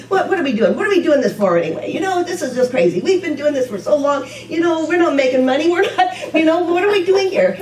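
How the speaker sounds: mock complaint. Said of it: "call Judy and say".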